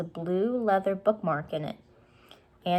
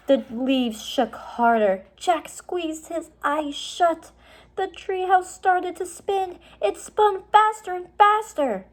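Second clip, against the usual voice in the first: rising higher and louder; building tension; 11 semitones higher, 6 dB louder than usual. A narrator's reading. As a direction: build the spin up to a peak. Narration builds with spinning.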